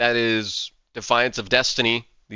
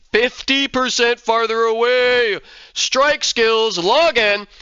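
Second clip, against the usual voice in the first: excited shouting; 9 semitones higher, 5 dB louder, much slower than usual. Real, not acted. Excited calling out to players.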